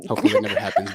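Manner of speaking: laughing through the line. Real, not acted. Host joking that dating a cousin never happens, laughing. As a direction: laugh as you say it, amused.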